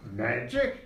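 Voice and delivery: very high, loud squeak of disbelief; mock incredulous. Orc answering a request for a healing potion, mock incredulous. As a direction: blurt it as a comic, high-pitched question. Orc's incredulous reply, acted out.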